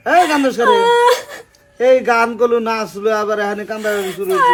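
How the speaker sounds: high, loud scolding over the wailing; exasperated shouting. Acted. Husband shouting at wife who suddenly began crying. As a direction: shout her down, baffled and fed up.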